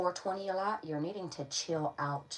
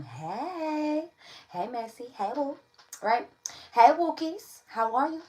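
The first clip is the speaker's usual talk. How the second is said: high, cooing hello to the pets; pet greeting; 8 semitones higher, 6 dB louder, slower than usual. Real, not acted. Reader greeting her pets Wookies with a high coo.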